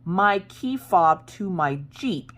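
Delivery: high, very slow, word-by-word stress; exasperated emphasis. Retold or imitated. Wife telling husband which key is missing, exasperated emphasis. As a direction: spell out the disaster with hammed-up urgency. Retold explaining why key matters.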